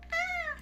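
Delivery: very high, short squeal; frustrated squeal. Real, not acted. Writer confusing themselves with words, frustrated squeal.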